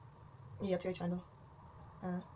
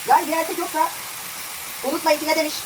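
loud, higher voice over noise; talking loudly; 5 semitones higher, 4 dB louder than usual. Real, not acted. Advising viewers over a noisy appliance, raised voice. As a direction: push the voice above the noise. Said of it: "bağırarak konuşuyorum".

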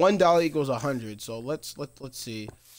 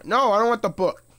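loud, high-pitched protest; exasperated yell at the game; frustrated outburst; 8 semitones higher, 7 dB louder than usual. Real, not acted. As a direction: shout it in sudden frustration. Game opens the wrong thing.

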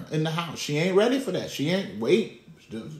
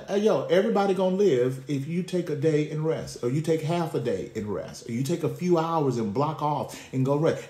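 ordinary talk

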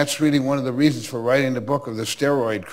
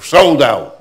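loud, curt brush-off; curt shout; 3 semitones higher, 11 dB louder than usual. Retold or imitated. Boston ticket clerk slams the window and turns them away, loud and curt. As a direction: bark it out like a fed-up ticket clerk.

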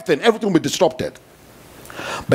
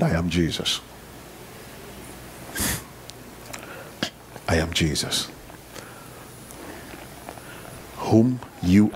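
low, soft, solemn voice of Jesus; voice of the Lord; 5 semitones lower, 7 dB quieter than usual. Acted. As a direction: speak as the Lord, quiet and grave. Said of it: "Then the Lord said".

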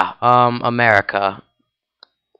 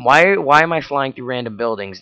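ordinary talk, nothing marked

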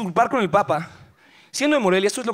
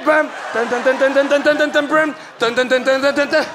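beatboxed beat; 4 semitones higher, slower than usual. Pretend. Imitated music beat.

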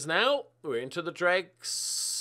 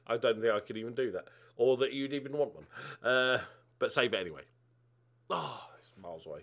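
usual speaking voice

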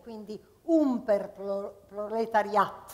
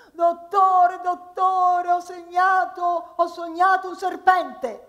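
much higher, louder singsong wail; whiny patient; 8 semitones higher, 9 dB louder, slower than usual. Acted. Patient wailing to the doctor about a dream.

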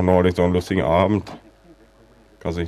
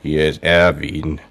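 slow and emphatic; 4 dB louder, slower than usual. Acted. Repeats name slowly to deaf listener.

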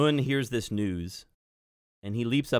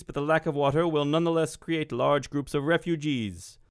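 higher, louder wizard dictating a message; wizard Mun; 6 semitones higher, 3 dB louder than usual. Acted. Wizard dictates to his apprentice for the Ministry Network, commanding declamation. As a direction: dictate urgent orders in a projected, declaiming voice. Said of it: "and he begins dictating".

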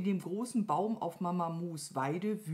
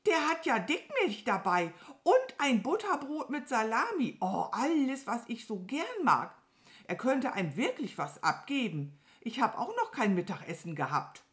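indignant, high start settling into a sulk; offended crow; 6 semitones higher than usual. A narrator's reading. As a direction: list the food enviously as a miffed crow. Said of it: "Die Krähe sah beleidigt aus". (beleidigt und neidisch nörgeln)

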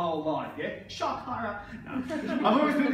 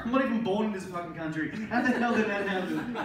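ordinary delivery

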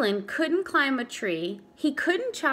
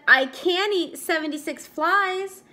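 proud claim; 7 semitones higher, 5 dB louder than usual. Acted. Franklin proud announcement.

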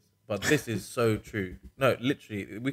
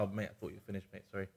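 mock apology; 13 dB quieter, much faster than usual. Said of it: "I just jump on as a joke".

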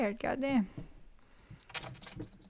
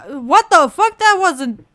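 loud, higher-pitched shock; startled outburst; 5 semitones higher, 11 dB louder than usual. Real, not acted. Player jolted by the horror game, startled. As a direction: blurt it out in real alarm.

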